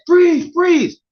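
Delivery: high, loud command to stop; retold police shout. Cop yelling at a man under the highway, loud high command. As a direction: bark it like a cop drawing his gun.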